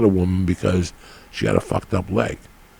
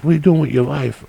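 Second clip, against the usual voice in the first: lightly voiced eager woman; 8 semitones higher than usual. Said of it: "she goes".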